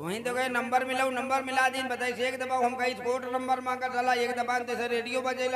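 high, excited complaint; excited high pitch. Acted. Excitedly explains to officer.

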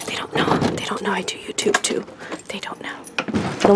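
hushed so neighbors won't hear; whispering. Real, not acted. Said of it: "I don't wanna yell so the neighbors".

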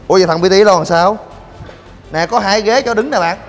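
ordinary talk

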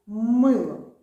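«Мило» with a very loud, drawn-out МИ; «Мило» with an exaggerated, stretched stress on И; strong stress on И. Shown as a demonstration. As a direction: boom out the И in «Мило». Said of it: "Ми підсилюємо нашу літеру И".

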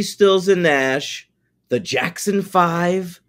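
usual speaking voice

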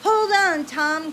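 loud, high urgent call; shout. Acted. Grand Dude shouting to Tom fallen from his horse, urgent. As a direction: call out to catch him before he falls. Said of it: "shouted Grand Dude".

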